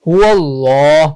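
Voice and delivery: "হু আল্লাহ" joined and drawn out slowly; slow linked "হু আল্লাহ"; joined recitation. Shown as a demonstration. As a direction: glide from হু straight into a long, full ল in "হু আল্লাহ". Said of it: "ওয়াও লামকে ধরেছে".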